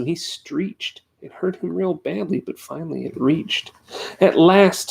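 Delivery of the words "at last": high, loud gleeful cry; crowing with delight. A narrator's reading. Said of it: "sang the Quagga Bug, chuckling with glee".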